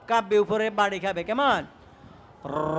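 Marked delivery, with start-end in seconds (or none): 2.4-2.8 র with the tongue tip tapping the upper gum, much lower; a rolled র like calling cattle; trilled র, low and quiet. Shown as a demonstration. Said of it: "জিহ্বার আগাটা একটু কাঁপবে".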